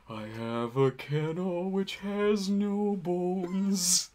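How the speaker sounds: high, slow dopey dog voice; slow, dim-witted big dog. Pretend. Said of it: "I'll be just sitting there like".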